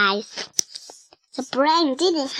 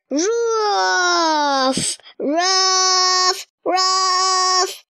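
higher voiced dog barks; dog barking; 5 semitones higher, much slower than usual. A narrator's reading. The dog Pell waking Arthur with barks. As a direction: bark it out like a playful puppy. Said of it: "barked Pell".

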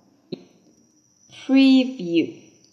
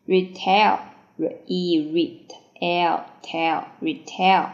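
normal talking voice

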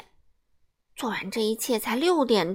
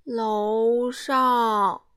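slow reply. Acted. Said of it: "一个缓慢的声音回答".

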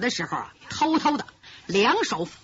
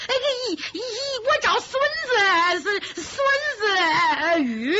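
much higher, stammering with a laugh in it; teasing stammer, pitched up; fake stutter; 7 semitones higher than usual. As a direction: stumble over the name on purpose to mock him. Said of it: "假装结巴".

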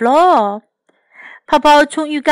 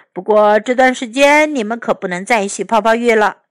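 usual speaking voice

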